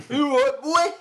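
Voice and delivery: hammed-up bouncer, high and loud; strained comic bruiser challenge; Lenny McLean. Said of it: "say he was a bouncer outside a nightclub".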